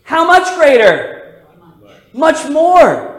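loud preacher's punch line; preaching call. Acted. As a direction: call it out over the congregation. Preacher calling out the answer.

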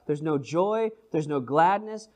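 usual speaking voice

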